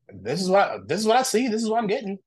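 pitched well up, restarting the phrase; bewildered, high-voiced self-quote; retold bewilderment. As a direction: insist in a high, disbelieving voice. Acting out stunned readings to friends.

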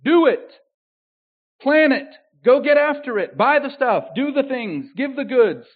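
high, loud, punchy orders; voicing God's loud, rousing commands; commanding voice of the Lord. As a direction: bark out each command. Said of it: "The Lord is telling them".

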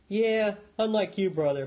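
sharp, loud snarling retort. A narrator's reading. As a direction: snap it back at your brother. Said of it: "Patrick snarled".

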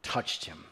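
"touched" set apart, hushed and low; stress on touched. Shown as a demonstration. Preacher emphasizing the healer's touch, hushed stress on "touched". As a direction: drop low and soft, weight on "touched". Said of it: "I want to emphasize the word touch".

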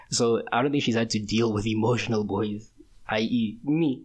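ordinary delivery

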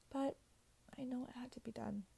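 quick resigned whisper. Real, not acted. Saying goodbye to a character, whispered. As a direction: whisper it fast, accepting the loss.

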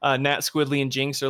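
normal talking voice

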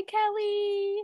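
high, slow sing-song hello; drawn-out, high-pitched hi to a friend; shout-out greeting. Real, not acted. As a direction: stretch out a cutesy greeting. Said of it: "I'm gonna give a shout out".

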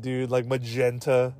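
ordinary talk

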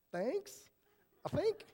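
high-pitched, hesitant mock gratitude; unsure thanks. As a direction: pipe up doubtfully, as the puzzled listener. Acted unsure reply to backhanded encouragement.

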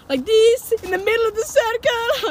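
high loud excited boss; boss showing his dance. Retold or imitated. Boss showing his desert dance, excitedly. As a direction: ham up the boss's proud demo. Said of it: "And he was like".

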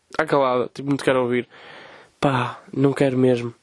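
normal talking voice

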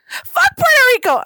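loud, high-pitched mock outrage; retold heated retort. Retelling a spat with friends, mock outrage.

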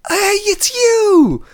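very high, loud, long-drawn mock greeting; overexcited greeting. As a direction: squeal hello like a long-lost acquaintance. Retells a customer's delighted greeting.

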